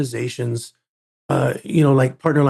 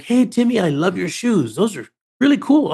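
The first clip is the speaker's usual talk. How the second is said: cheerful nurse; 6 semitones higher than usual. Retold or imitated. Nurse cheering up hurt boy.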